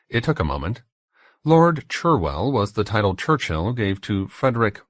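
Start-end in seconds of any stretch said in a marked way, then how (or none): none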